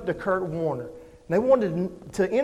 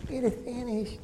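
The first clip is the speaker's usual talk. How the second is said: much softer, higher limp version; weak wrong way; 4 semitones higher, 11 dB quieter than usual. Shown as a demonstration. Preacher showing how Jesus did not say it, softly. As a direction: say it meekly, without force. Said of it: "It wasn't like that".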